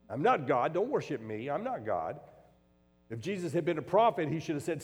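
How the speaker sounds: loud, high-pitched preaching; fervent pulpit preaching; preaching at full force. Acted. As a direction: drive the point home to the congregation. Preacher rising into forceful delivery.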